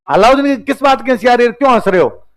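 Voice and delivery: a sultan's angry challenge; angry sultan demand. Retold or imitated. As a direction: snap at them for laughing. Sultan confronts laughing ministers.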